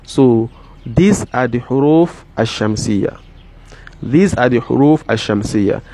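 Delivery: ordinary talk, nothing marked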